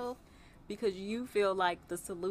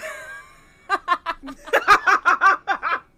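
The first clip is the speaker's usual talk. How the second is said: hearty high laugh; laughing out loud; 10 semitones higher, 13 dB louder than usual. Real, not acted. Laughing after long story.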